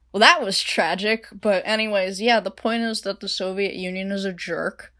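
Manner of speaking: loud, high, animated rant; loud, high, teasing commentary; playful author aside. Real, not acted. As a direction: jokingly defend the story to listeners. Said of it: "author's loud playful commentary".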